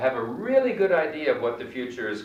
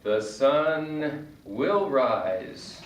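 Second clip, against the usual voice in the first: very slow, careful The sun will rise; slow example; 2 semitones higher, much slower than usual. Shown as a demonstration. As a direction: stretch out every word of The sun will rise. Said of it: "For example".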